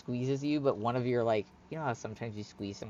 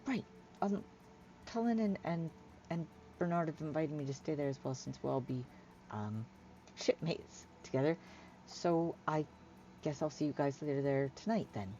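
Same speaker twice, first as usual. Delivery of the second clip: higher, hesitant, lightly voiced figure; awkward polite Hyla; 4 semitones higher, 7 dB quieter than usual. Hyla arranging to meet her shipmates, awkwardly polite. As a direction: politely fumble through the arrangements.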